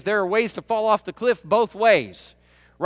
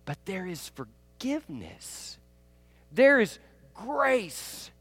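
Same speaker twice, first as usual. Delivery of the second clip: tender assurance; 5 dB quieter, much slower than usual. Acted. Sermon climax, softened over music.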